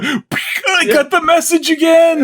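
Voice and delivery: high, loud hammed-up cry; mock alarmed shout. Leo warning everyone in a hammed-up alarmed yell. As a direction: yell the warning like a panicked hero.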